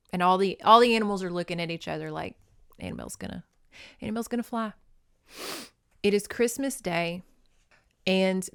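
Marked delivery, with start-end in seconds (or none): 2.8-4.7 soft mock-secretive murmur; hushed aside. Animals looking at each other, hushed guessing. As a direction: keep it down, as if passing on a rumor.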